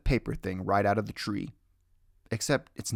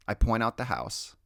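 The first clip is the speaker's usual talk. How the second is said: usual speaking voice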